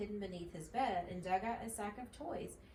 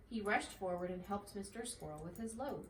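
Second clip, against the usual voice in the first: usual speaking voice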